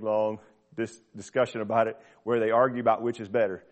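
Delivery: ordinary talk, nothing marked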